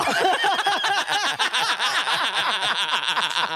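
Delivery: laughing at the mix-up, high helpless giggle. Real, not acted. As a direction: crack up at yourself. Laughs at own mistake.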